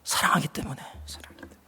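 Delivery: breathy whisper, very soft; whispered answer. Shown as a demonstration. Preacher whispering God's reason to the church. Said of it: "주님이 책망하고 징계하는 이유가 뭐라고요?".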